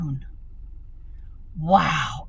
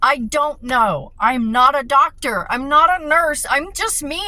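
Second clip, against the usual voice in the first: higher, louder breaking plea; anguished cry of someone at the end of her rope; desperate outburst; 7 semitones higher, 6 dB louder than usual. Retold or imitated. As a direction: pour out despair to her dad. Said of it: "I said".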